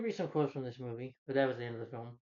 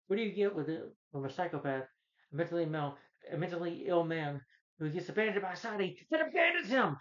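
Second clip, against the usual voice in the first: building angry rant; 5 semitones higher than usual. Retold or imitated. Arthur ranting at the talk show host.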